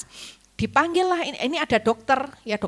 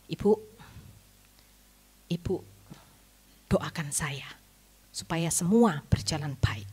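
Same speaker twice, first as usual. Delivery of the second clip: hushed plea; 4 semitones lower than usual. Retold or imitated. Doctor asks her to pray.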